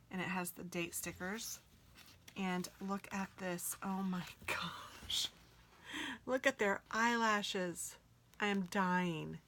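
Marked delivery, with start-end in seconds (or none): 3.8-5.3 slow whisper breaking into a giggle. Real, not acted. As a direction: whisper it, half laughing. Whispering with a laugh.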